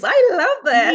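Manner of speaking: high, loud burst of laughing delight. Real, not acted. Host cracks up at the guest's knees pun, high and laughing. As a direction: squeal it out, tickled by the wordplay.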